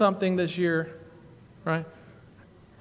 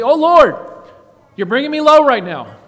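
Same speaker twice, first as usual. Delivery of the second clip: loud cry to God; 7 semitones higher, 11 dB louder than usual. Acted.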